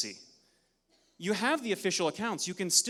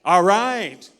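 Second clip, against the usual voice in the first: shout; 2 semitones higher, 13 dB louder than usual. Real, not acted. Crowd cheer, presenter shouts over.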